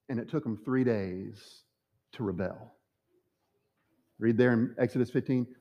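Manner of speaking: ordinary talk, nothing marked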